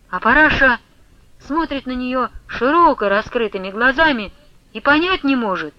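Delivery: ordinary delivery, nothing marked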